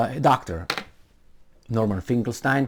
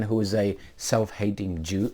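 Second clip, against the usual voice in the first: usual speaking voice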